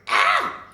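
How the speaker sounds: loud, voiceless "ah" exhale; burning-throat gasp after a swig; sharp drink gasp. Acted. Reacting to a strong drink, loud gasp.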